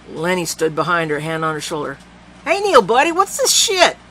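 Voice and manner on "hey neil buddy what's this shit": high, loud worried interruption; alarmed friend. A narrator's reading. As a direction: step in to calm him, alarmed.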